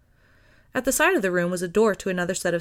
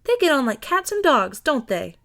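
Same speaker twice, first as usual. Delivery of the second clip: beaming; 6 semitones higher, 4 dB louder than usual. A narrator's reading.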